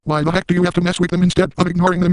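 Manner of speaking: high-pitched mock meltdown at the trolls; spaz rant. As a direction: rattle it off in a hyper, unhinged fit. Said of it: "I will spaz at some of these comments".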